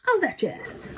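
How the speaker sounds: high, showy flourish; playful, put-on high voice; theatrical catchphrase. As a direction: toss it off with high showbiz flair.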